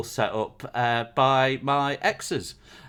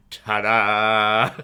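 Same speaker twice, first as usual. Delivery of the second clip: triumphant reveal; 2 semitones lower, 5 dB louder than usual. Pretend.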